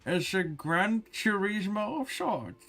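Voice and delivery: high, slow, garbled through food; mouth full. Acted. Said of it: "she's talking with her mouth full".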